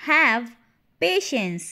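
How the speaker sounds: loud "Have", a pause, then "patience"; word by word. Shown as a demonstration. Teacher repeats "Have patience" for learners, word by word. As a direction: punch "Have" and set "patience" apart.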